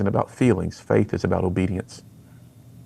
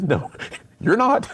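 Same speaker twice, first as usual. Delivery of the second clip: laughing it off, voice jumping high; a bemused wife laughing as she says it; slowed, laughing mock rebuke; laughing retort; 11 semitones higher, slower than usual. Wife contradicting him, laughing.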